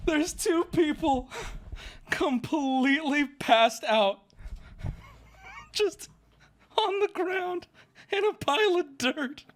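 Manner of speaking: high, drawn-out words squeezed through laughter; helpless laughter. Real, not acted. Storyteller describing passed-out friends, helplessly laughing. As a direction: fight the laughter to get it out.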